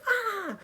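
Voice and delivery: mock shriek. Reaction to blood water.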